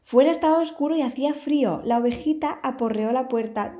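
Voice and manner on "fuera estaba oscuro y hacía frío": usual speaking voice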